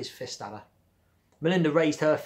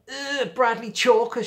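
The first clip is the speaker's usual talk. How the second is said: a high, slow, disgusted groan of a name; 11 semitones higher, 8 dB louder, slower than usual. Acted. Girl to Bradley, disgusted and drawn out. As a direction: sneer his name like something gross. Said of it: "making a face".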